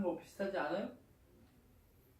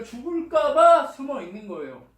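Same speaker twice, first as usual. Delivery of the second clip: loud, raised stress on "죽을까 봐"; emphatic; 8 semitones higher, 14 dB louder than usual. Real, not acted. Preacher hammers home why Elijah is hiding, "죽을까 봐" stressed loudly. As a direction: punch "죽을까 봐" high and loud.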